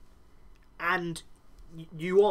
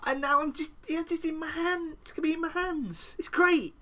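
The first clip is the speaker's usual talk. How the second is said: high, gloating Cole voice, slow at first; gloating high mock Cole; smug Cheshire cat; 7 semitones higher than usual. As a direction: grin through it like a Cheshire cat. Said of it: "he is like a Cheshire cat".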